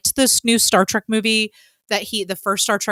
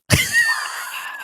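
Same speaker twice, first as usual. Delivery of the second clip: real, high-pitched laughing fit; high squealing laugh. Real, not acted. Host cracking up after her glove comparison, squealing laugh.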